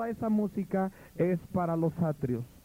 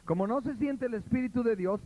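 ordinary talk, nothing marked

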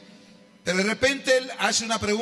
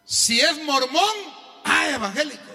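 preaching; 5 semitones higher, slower than usual. Acted. Preaching at full force.

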